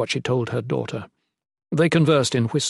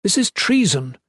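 higher-pitched indignant accusation; queen's rising outrage; accusing, anger rising; 8 semitones higher than usual. A narrator's reading. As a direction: accuse him with mounting anger. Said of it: "the Shalane accused him, anger rising".

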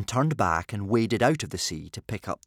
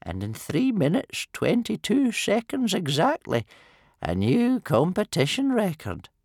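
high-pitched, regretful announcement; sad old timekeeper; 11 semitones higher, 4 dB louder than usual. A narrator's reading. Old Wrinkly reading out the losing time, sad high voice. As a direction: report the result sorrowfully in a high voice. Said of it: "he said sadly".

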